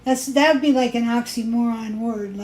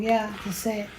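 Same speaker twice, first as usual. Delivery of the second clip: ordinary talk, nothing marked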